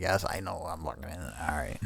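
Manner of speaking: very quiet, low retold concession; sheepish giving in. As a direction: trail down into a grudging low mumble. Acts out giving in when scolded.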